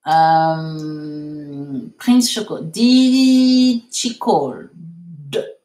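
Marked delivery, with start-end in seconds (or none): 2.6-4.7 first word of "Di Chicol" stretched very long and loud; drawn-out model. Shown as a demonstration. Teacher modelling the corrected question for students, drawn out. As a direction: linger on "Di" in "Di Chicol", then finish. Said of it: "non, Di Chicol".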